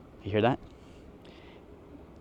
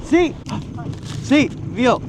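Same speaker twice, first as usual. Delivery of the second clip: excited cheer; 9 semitones higher, much slower than usual. Real, not acted. Reacting to hippo sighting.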